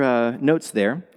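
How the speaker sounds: ordinary talk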